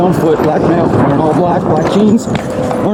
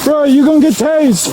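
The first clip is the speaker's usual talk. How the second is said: yelling across the room; 7 semitones higher than usual. Real, not acted. Officer warning suspect in woods.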